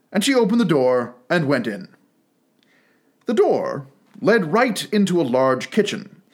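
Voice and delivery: ordinary delivery, nothing marked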